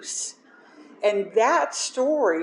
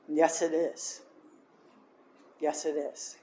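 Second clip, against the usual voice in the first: softly, fading to a murmur; quiet; 3 semitones lower, 7 dB quieter than usual. Real, not acted. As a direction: say it low, almost to yourself. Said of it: "repeated quiet agreement".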